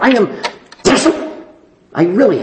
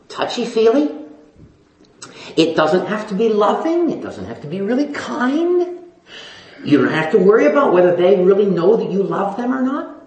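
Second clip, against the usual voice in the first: ordinary delivery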